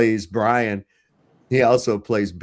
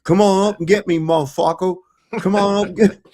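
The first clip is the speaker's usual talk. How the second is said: higher, taunting hillbilly yell; rock-throwing oddball; 9 semitones higher, 5 dB louder than usual. Retold or imitated. Gas-huffer taunting police from the church roof, high and mocking. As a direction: taunt the cops from a rooftop. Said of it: "that's how he talked".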